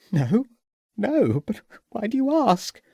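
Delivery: a shaken hostess, voice lifted with worry; distressed hostess. A narrator's reading. Hostess answers the detective.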